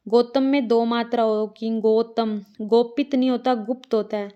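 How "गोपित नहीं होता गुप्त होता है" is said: normal talking voice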